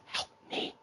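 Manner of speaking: voiceless whisper as a captive goblin; mouthed plea. Acted. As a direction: barely breathe out a secret cry for help. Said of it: "The goblin mouths".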